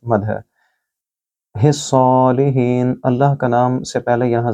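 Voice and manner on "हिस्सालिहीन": हिस्सालिहीन slowly, joined and drawn out. Shown as a demonstration. Teacher isolating the ending, slow joined recitation. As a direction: draw the phrase out smoothly. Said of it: "बा को लंबा करें".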